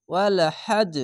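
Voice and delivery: final জ of হাজ bounced with a jolt; qalqala bounce. Shown as a demonstration. Teacher demonstrating the bounced জ of হাজ to students. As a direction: give হাজ a hard echoing stop. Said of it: "ঝোঁক মেরে পড়তে হবে".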